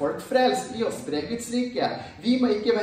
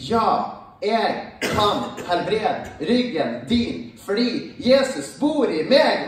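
bold, not politically correct; 4 semitones higher, 4 dB louder than usual. Retold or imitated. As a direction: declare it like a bold believer, drawing it out. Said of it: "i stedet for å være en sånn her ... politisk korrekt kristen".

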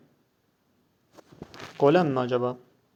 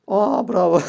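high, loud surprised bravo; surprised cheer; 7 semitones higher, 7 dB louder, slower than usual. Real, not acted. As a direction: call out amazed. Reacts to an opponent's play.

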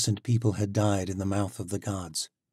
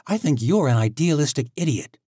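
higher, louder, biting insult; scornful; 7 semitones higher, 7 dB louder than usual. Acted. Tori insults Alec, higher, louder and scornful. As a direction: cut him down.

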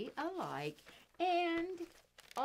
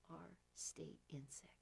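whisper; 4 semitones lower, 15 dB quieter than usual. Shown as a demonstration. Teacher whispers quiz question.

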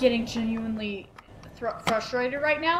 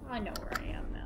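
whispered under the breath, relieved; barely voiced, to yourself; 6 semitones lower, 12 dB quieter, much faster than usual. Real, not acted. Player whispering to himself while sneaking, hushed. Creeping through dark building.

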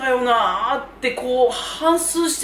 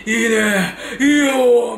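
breathy, louder teasing lover line; mock BL line; 4 dB louder, much slower than usual. Middle school BL fan acting out a romantic line. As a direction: play a swooning BL love interest. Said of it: "みたいな感じのビーエル".

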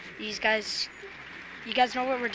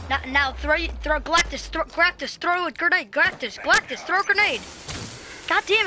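urgent shouting; 6 semitones higher, 6 dB louder than usual. Real, not acted. Urging teammate to throw grenade.

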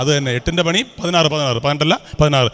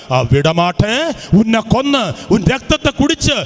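slow, possessed dancer's voice climbing higher; menacing Tamil-tinged chant, slow and ever higher; Shobhana as Nagavalli; 7 semitones higher, slower than usual. Retold or imitated. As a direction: drag it out like a spirit taking over, rising as it builds. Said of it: "Manichitrathazhu സിനിമയില് ശോഭന കാണിക്കുന്ന പോലെയാ".

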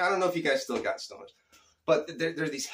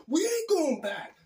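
high, slow imitation of a defiant friend; mock movie-style refusal; 13 semitones higher than usual. Friend refusing to go back for the shoe, high drawn-out mock defiance. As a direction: stretch it out high, like the film scene. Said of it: "Dude, it was like that".

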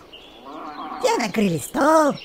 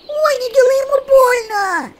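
much higher, louder frightened plea; alarmed cry; 13 semitones higher, 5 dB louder than usual. A narrator's reading. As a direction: cry out to stop the cat. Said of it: "встревоженно воскликнул цыплёнок".

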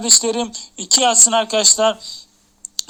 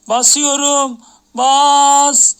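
higher and louder, climbing to a shout; rising excited shout; 4 semitones higher, 5 dB louder, much slower than usual. Real, not acted. Vlogger at the box opening climax, rising excited shout. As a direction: let it peak on the final press.

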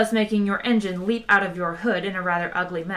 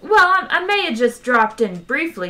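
high, loud, stammered backtracking; breezy; 6 semitones higher, 5 dB louder than usual. A narrator's reading. Zaphod admits to Ford he dropped in, breezy. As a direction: admit it lightly, pitched up. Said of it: "said Zaphod breezily".

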